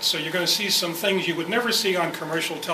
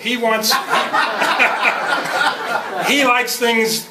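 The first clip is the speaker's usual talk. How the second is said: laughing; 6 semitones higher, 6 dB louder, much slower than usual. Real, not acted. Laughs about the annoyed director.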